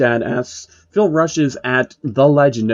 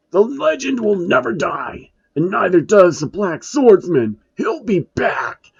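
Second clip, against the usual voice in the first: dying villain vow; 8 semitones higher than usual. A narrator's reading.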